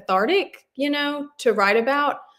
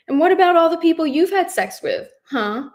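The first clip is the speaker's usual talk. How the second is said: sharp, defensive challenge, raised; defensive demand; 7 semitones higher, 3 dB louder than usual. A narrator's reading. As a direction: throw the question back at her. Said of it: "he demands defensively".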